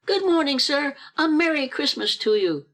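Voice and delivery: high, cheery greeting; good-humored. A narrator's reading. Passers-by greeting Scrooge in the street, good-humored. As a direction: sing out a hearty holiday greeting. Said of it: "good-humored".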